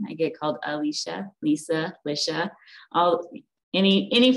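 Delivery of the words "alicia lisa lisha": "Alicia", "Lisa", "Lisha" as listed wrong names; butchered names. Retold or imitated. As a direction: say "Alicia" as a-LEE-sha, "Lisa" as LEE-sa, "Lisha" as LISH-a. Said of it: "it gets butchered all the time".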